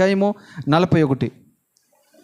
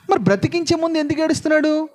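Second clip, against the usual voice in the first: pointed question; 12 semitones higher than usual. Shown as a demonstration. Preacher pressing the congregation, question pitched high. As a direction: question leaps high, stressing మరి. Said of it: "అది మీరు point పట్టుకోవాల్సింది".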